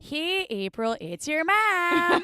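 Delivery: put-on loud drawn-out Wisconsin mom accent; slow Midwest mom on voicemail. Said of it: "I'm busting my Midwest accent out".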